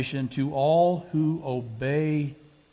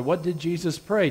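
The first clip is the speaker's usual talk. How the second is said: ordinary talk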